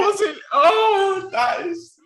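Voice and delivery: very high, loud delighted squeal; excited shriek. Real, not acted. Listener reacting to the prank story with a loud high squeal. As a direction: squeal with glee at the twist.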